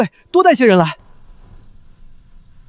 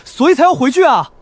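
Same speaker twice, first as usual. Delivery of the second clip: high-pitched, agitated plea; exasperated, high insistence; urgent protest; 6 semitones higher than usual. Acted. Alarmed man argues to retreat.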